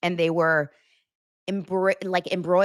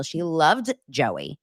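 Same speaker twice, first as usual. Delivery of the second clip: ordinary talk, nothing marked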